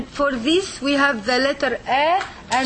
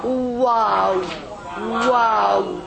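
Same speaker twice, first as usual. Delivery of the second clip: ordinary talk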